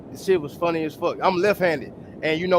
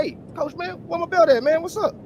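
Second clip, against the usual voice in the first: retold puzzled plea; 11 semitones higher than usual. Knocked-out fighter asks coach for his belt, mock puzzled. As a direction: ask it high and baffled.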